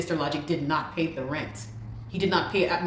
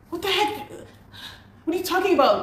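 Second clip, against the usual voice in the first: much higher, shocked What the heck; shocked outrage; 12 semitones higher than usual. Real, not acted. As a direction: squeal the disbelief at the news. Outrage at unpaid rent.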